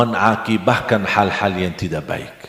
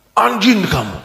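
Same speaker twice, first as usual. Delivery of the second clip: higher, spitting out an insult; angry insult; 8 semitones higher than usual. Retold or imitated. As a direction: mimic an angry stranger's curse. Angry pilgrim insults the speaker. (menirukan makian orang yang marah)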